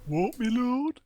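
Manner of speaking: high, loud peasant voice; cringing servant answering his lord. Acted. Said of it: "peasant voices with".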